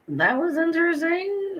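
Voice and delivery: high, put-on wry voice; wry remark. To herself about the wasted trip, high wry remark. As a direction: pitch it up high, amused disbelief. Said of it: "And I was like".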